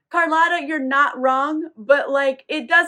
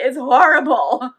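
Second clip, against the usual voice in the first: ordinary talk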